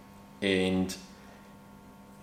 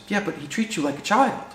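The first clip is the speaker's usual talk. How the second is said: higher, mock-indignant wife's retort; 11 semitones higher, 4 dB quieter than usual. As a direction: play the wife pushing back at her husband. Wife scolds husband in story.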